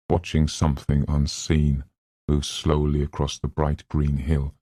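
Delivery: plain reading voice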